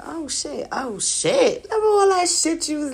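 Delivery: building to a high, loud exclamation; mock astonished outburst; imagined startled reaction. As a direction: act out his shock, rising and quickening. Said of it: "He gonna be like".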